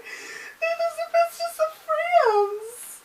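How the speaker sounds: very high, slow wailing cry; mock sobbing. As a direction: wail it out through fake tears. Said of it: "I'm just like".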